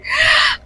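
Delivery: laugh. Real, not acted. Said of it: "I'm laughing hard here".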